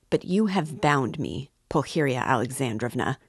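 plain reading voice

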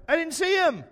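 high, loud mock-innocent quip; sarcastic. Son teasing his praying mom, high and loud sarcastic. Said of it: "me being sarcastic".

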